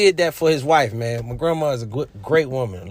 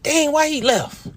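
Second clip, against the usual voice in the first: raised, upset why; upset cry; 12 semitones higher than usual. Retold or imitated. Grieving grandson asking why he left, high and hurt. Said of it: "I'm like".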